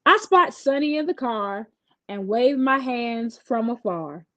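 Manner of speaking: usual speaking voice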